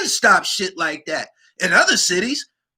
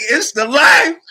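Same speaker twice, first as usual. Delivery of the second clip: louder, higher, into a howling laugh; laughing shout; 10 dB louder than usual. Real, not acted. Celebrating the lyric, shouting laugh. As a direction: shout it and crack up.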